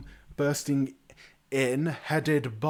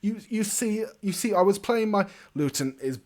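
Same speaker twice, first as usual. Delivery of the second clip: higher, louder wheedling voice; pleading bard brother; 6 semitones higher, 5 dB louder than usual. Acted. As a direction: plead your innocence, pitch climbing. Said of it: "His brother walks over to him and goes".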